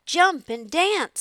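high, loud, slow rooster crow. A narrator's reading. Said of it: "said the rooster".

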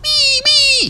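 loud, very high "wee, wee"; straining toy-siren squeal; gasped vehicle noise. A narrator's reading. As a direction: force out a high squeal with little breath left. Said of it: "he managed to gasp".

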